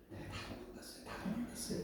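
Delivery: near-whispered confession; barely able to admit it; ashamed whisper. Acted. John confessing in shame. (부끄러워 속삭이듯)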